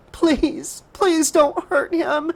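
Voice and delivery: high, slow tearful plea; pleading. Acted. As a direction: beg for his life. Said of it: "Casey pleaded".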